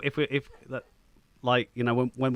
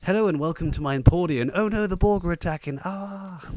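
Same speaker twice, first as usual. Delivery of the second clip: higher, louder theatrical British voice; hammed-up British accent; 8 semitones higher, 6 dB louder than usual. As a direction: play a doomed British host, overdoing the accent. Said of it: "That was my best, best British accent".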